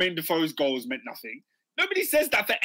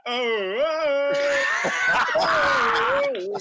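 mock wailing; 7 semitones higher, 6 dB louder, much slower than usual. Imitating Adrian's crying next season.

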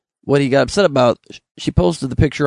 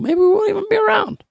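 squeaky high put-on voice; high mock official. Social Security admitting to citizens it may vanish, high mock voice. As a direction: say it way up high, mocking. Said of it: "what we keep saying".

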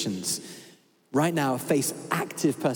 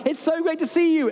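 bright, much higher, bubbling over; gushing email voice; 11 semitones higher, 3 dB louder than usual. Retold or imitated. Preacher reading his own email, gushing and high. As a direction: read it like every line ends in an exclamation mark. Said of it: "each sentence is like".

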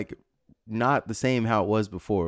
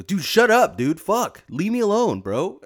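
higher, louder retold outburst; yelling at my brain; 9 semitones higher, 6 dB louder, slower than usual. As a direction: snap out loud at the voice in your head. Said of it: "she just hears me go".

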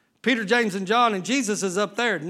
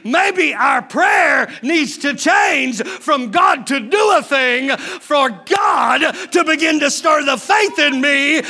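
loud, higher, shouting sermon; full-force preaching; 4 semitones higher, 5 dB louder than usual. Real, not acted. Preacher shouting to his church, full force. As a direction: drive it home to the congregation.

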